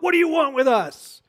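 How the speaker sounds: high, loud demon shriek; possessed man crying out. Acted. Possessed man crying out at Jesus, a loud high demonic shriek. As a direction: cry out like a tormented spirit. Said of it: "an evil spirit cried out".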